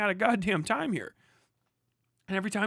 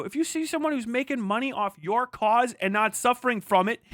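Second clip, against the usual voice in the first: normal talking voice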